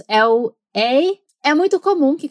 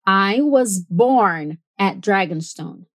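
slow, clear American-accent demo; American English with the R in "born" pronounced; 3 semitones lower, slower than usual. Shown as a demonstration. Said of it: "repetir essa mesma frase com um accent americano".

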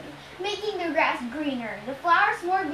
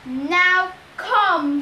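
loud, raised monster command; shouted order; 4 semitones higher, 7 dB louder than usual. A narrator's reading. Cyclops orders his captive to come, shouting. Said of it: "he shouted".

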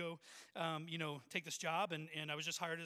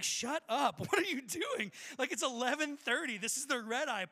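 loud, raised-pitch mock outrage; exasperated protest; 4 semitones higher, 6 dB louder than usual. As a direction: plead in exasperation with a noisy seatmate. Exasperated at noisy seatmate, retold.

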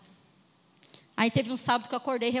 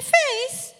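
emphatic retort; 10 semitones higher, 4 dB louder than usual. Retold or imitated. Flirting, calling the man.

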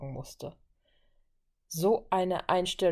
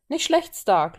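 approving praise, louder and higher; praising; 5 semitones higher, 7 dB louder, slower than usual. A narrator's reading. Steve acknowledges Tony's log split, praising. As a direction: hand out a lively compliment. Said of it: "lobte Steve".

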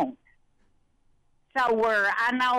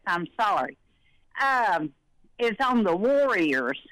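ordinary delivery

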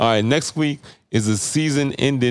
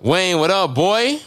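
hype shout-out; 9 semitones higher, much slower than usual. Real, not acted. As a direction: call out to a friend with energy. Shouting out listeners on podcast.

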